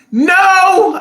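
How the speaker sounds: loud, high, drawn-out no; emphatic no. Real, not acted. Answering a viewer's question, loud and emphatic. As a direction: belt out a long, emphatic refusal.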